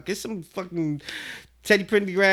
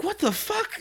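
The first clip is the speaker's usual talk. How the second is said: startled disbelief; 13 semitones higher than usual. Retold or imitated. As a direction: jump back in shock as you say it. Startled reaction, retold.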